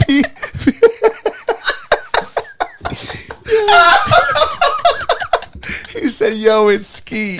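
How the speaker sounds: high, slowed words through laughter; real laughing fit. Real, not acted. Co-host savouring the Skeet text, really laughing. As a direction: struggle to talk while cracking up.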